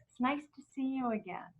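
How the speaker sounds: very soft, intimate murmur; hushed greeting. Shown as a demonstration. Speaker greeting little kids, very hushed. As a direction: drop right down, as into a child's ear. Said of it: "I whisper in their ear".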